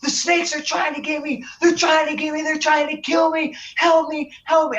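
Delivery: high-pitched, held-back mock scream of a panicked child; strained high cries of terror, imitated; imitated terrified scream. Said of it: "at the top of his voice".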